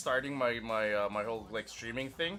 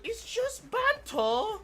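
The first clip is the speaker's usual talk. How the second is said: much higher, slow British "It's just banter."; British accent; much slower than usual. Retold or imitated. Streamer mocking British chat, in a high British accent. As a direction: do a cheeky British banter line. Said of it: "the British call it".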